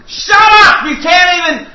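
high, loud mock outburst; comic re-enacted shout cutting someone off; retold yell. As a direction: yell it at the singer, hammed up. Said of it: "I yelled at the guy singing".

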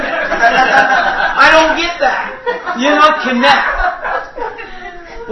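slow, drawn-out mock teacher voice, laughing. Preacher mimicking his piano teacher, slow and laughing. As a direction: stretch Connect out like a fussy teacher. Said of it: "what my piano teacher says".

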